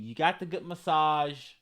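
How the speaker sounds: normal talking voice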